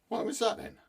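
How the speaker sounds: a high questioning father's voice. A narrator's reading. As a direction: ask the son why he's late. Father questions returning son.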